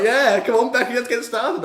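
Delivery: impersonating a smug wrestler goading her rival; smug taunt. Retold or imitated. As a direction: sneer it like a cocky champ. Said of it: "she was just looking all smug".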